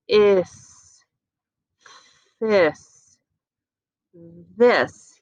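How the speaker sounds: "This" built from "Is" with a TH at the start; teacher blends the word for children; blending this. Shown as a demonstration. Teacher blending this. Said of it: "Are you ready to hear it?".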